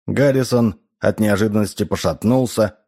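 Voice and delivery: ordinary delivery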